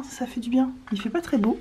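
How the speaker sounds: ordinary talk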